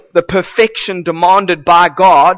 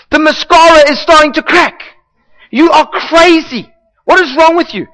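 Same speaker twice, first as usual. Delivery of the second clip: a lightly voiced nagging husband, higher as it builds; 6 semitones higher than usual. Preacher voicing the law as a nagging husband. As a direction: scold her like a fussy husband.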